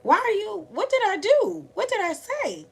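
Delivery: high-pitched, hurt pleading; overwhelmed child. Retold or imitated. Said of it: "I would get so overwhelmed".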